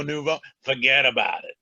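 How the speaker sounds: put-on Philly accent, punchy "forget about it"; West Philly accent. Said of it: "my best West Philly accent".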